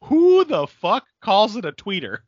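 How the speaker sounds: high, loud disbelieving outburst; who says that, loud and high; incredulous exclaim. Retold or imitated. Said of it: "I'm like".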